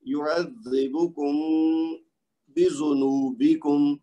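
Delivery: very slow, drawn-out recitation of یعذبکم بذنوبکم; tajweed example. Shown as a demonstration. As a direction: stretch the meem ending یعذبکم before بذنوبکم. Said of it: "آخر میں میم ساکن ہے".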